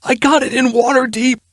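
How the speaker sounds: high, frantic cry; panicked ruffian. Acted. Ruffian frantically answers Erilyn, high and panicked. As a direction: blurt it out desperately.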